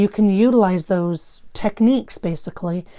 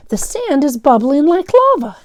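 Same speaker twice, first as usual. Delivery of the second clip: frightened; 7 semitones higher, 6 dB louder than usual. Acted. Frightened kid in story.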